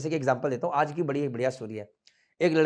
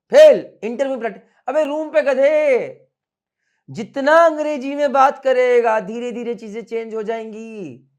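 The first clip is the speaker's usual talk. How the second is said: raised-voice coach driving the point home; loud scolding; 6 semitones higher, 8 dB louder than usual. Real, not acted. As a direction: holler it at lazy students, stretching the words. Coach loudly scolds students about practice.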